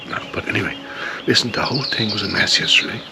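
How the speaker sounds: normal talking voice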